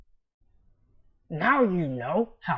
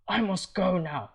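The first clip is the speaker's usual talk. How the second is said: ordinary talk, nothing marked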